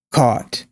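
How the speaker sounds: "caught" with rounded AW, falling; caught, AW vowel. Shown as a demonstration. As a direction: say "caught" as kawt, tune down. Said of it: "repeating four or five times".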